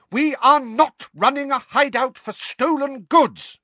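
high, loud furious yell; shouting. A narrator's reading. Mrs Weasley shouting at Mundungus downstairs, furious yell. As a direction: bellow it at the top of your lungs. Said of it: "shouting at the top of her voice".

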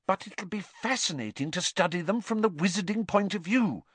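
high-pitched, keen insistence; earnest. A narrator's reading. Hermione defending her Muggle studies to Ron, earnest. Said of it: "said Hermione earnestly".

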